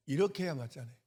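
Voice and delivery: ordinary talk, nothing marked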